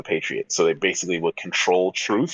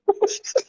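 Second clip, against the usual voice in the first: 1 high burst of laughter. Real, not acted. Guest can't answer quiz.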